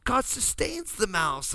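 high, slow, sweet voice; gentle wife. Retold or imitated. Husband voicing his sweet wife defending the mouse. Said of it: "she's like".

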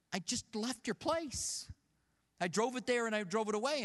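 replaying an exasperated customer's complaint; retold indignant protest. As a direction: protest in disbelief, pitch lifted. Retold protest to the oil shop.

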